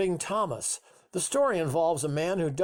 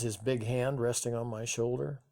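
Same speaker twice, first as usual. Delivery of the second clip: ordinary delivery, nothing marked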